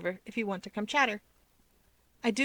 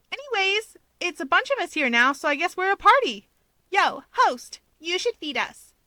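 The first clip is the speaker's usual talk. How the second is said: put-on higher, cheeky young man voice; playful Hawks; 10 semitones higher, 6 dB louder than usual. A narrator's reading. Hawks cheekily demands the host feed them, lightly voiced. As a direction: tease the host for food. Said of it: "Hawks argues".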